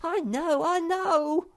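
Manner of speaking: squeaky exasperated repeat; impatient interruption. A narrator's reading. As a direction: talk over him, fed up. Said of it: "interrupted Sniff".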